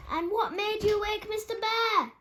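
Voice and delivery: high, loud demanding shout; furious dormouse demand; angry shout. A narrator's reading.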